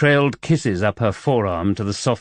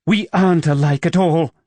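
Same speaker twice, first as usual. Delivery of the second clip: shot back; 7 semitones higher, 4 dB louder than usual. A narrator's reading. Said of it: "she shot back".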